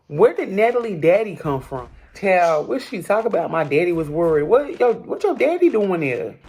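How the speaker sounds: can't believe what she just heard; incredulous. Real, not acted. Disbelief at Natalie's daddy.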